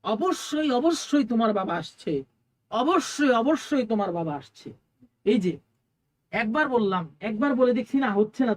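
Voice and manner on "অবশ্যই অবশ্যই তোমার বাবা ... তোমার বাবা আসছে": অবশ্যই hammered again and again, raised; insistent repetition. Shown as a demonstration. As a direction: convince a doubter by pounding অবশ্যই. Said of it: "আমি আরো তাকিদ দিয়ে বলবো".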